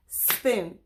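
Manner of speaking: spoon said as one beat, one clap; clapped syllable. Shown as a demonstration. Teacher to kids, clapping out the syllables. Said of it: "Let's say the word spoon and count the syllables".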